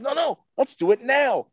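high, loud, urgent insisting; eager insistence. Retold or imitated. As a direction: insist excitedly, pitch way up. Retold star insists eagerly.

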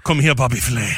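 loud put-on Italian accent; Giada De Laurentiis. Mock Giada beckoning a fellow chef, Italian accent. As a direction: ham up the chef's Italian accent. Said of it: "Just hate how she has a random accent".

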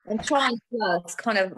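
ordinary talk

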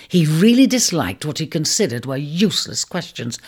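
ordinary delivery, nothing marked

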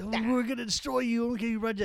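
high mocking wrestler voice; imitating wrestler. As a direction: parrot his threat in a high voice. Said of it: "He said".

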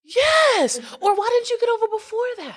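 high-pitched, incredulous scolding; mock exasperation. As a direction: scold a careless driver in hammed-up disbelief. Scolding an imagined careless driver.

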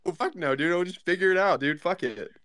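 ordinary delivery

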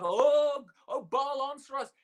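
high mock call that fades away; imitated crying out. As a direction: imitate worshippers calling on their god. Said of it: "the prophets of Baal crying out".

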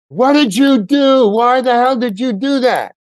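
high, loud, slow mock yell at the producers; angry shout. Retelling how he shouted at the producers, loud slow angry yell. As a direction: belt it out like you've lost it. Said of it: "what I had just done with the shout".

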